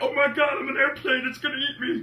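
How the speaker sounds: high, very loud hammed-up terror; mock panic scream. Host voicing an airplane about to be eaten, mock panic scream. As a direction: shriek like a doomed airplane.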